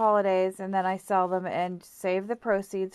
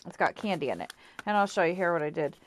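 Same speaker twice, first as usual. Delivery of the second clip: ordinary talk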